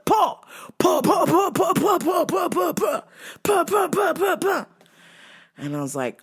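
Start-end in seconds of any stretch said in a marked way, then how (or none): 0.1-4.7 E Honda slaps. Retold or imitated. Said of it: "Like fucking E Honda".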